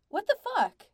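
high, loud bewildered cry; baffled outburst. A narrator's reading. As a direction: burst out in disbelief. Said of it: "Pete was equally baffled".

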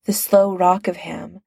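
plain reading voice

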